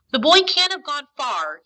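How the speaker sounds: mildly desperate urging. A narrator's reading. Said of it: "he urged desperately".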